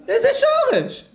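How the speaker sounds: high, loud outburst of disbelief; shrieked comic incredulity; exasperated mock shriek. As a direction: squawk it in comic outrage. Rabbi mocks woman in acted quarrel.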